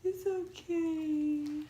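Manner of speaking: high, very slow comforting voice; soothing friend. Retold or imitated. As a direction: draw it out gently like calming a crying friend. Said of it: "She's like".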